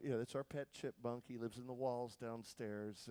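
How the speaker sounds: soft, low, unhurried dad; hushed, low voice of a father; dad's voice. Retold or imitated. Voicing dad in a story.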